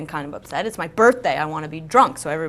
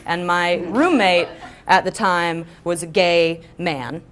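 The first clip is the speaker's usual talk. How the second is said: normal talking voice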